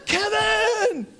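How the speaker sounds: high, loud call with a laugh; laughing shout. Retold or imitated. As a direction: yell his name over the crowd. Calling Kevin after home run.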